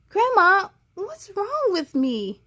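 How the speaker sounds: mildly distressed plea; pleading child. Acted. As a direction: ask Grandma helplessly.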